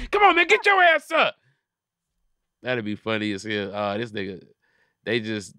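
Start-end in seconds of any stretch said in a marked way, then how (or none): 0.1-1.4 mock shout. Yelling at fainted goat.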